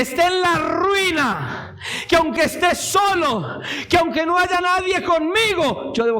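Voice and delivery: high, driving list building to a shout; impassioned preaching. Acted. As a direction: hammer each example home to the congregation. Preaches at full force.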